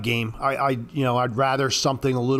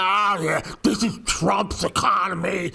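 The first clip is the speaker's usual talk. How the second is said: lightly voiced mock complaint, a bit higher; mocking complainer; 5 semitones higher than usual. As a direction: parody someone blaming the president. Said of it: "people just freak out".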